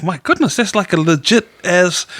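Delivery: high-pitched retold astonishment; mock amazement. Host retelling his reaction to her voice, mildly amazed. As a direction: lift the pitch, lightly playing amazed.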